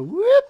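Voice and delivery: spoken swooping noise, sliding sharply up; rising whoop. Shown as a demonstration. Player showing how the raft drags, rising whoop. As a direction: whoop upward like a quick sound effect.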